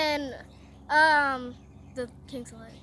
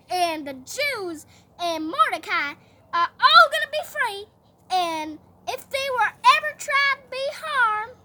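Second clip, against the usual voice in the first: put-on loud, higher country-accented decree; 6 semitones higher, 7 dB louder than usual. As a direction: lay down the law with country twang. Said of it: "my country accent".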